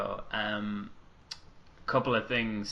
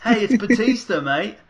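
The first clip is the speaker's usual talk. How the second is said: put-on high, loud Australian kid; attempted Australian accent; 10 semitones higher, 10 dB louder than usual. Shown as a demonstration. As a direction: shout it in a shaky-attempt Aussie accent. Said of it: "Can I do an Australian accent?".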